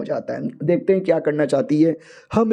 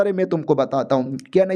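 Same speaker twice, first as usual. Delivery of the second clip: ordinary talk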